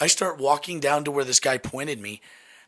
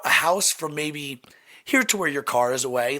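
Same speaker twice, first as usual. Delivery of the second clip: ordinary talk, nothing marked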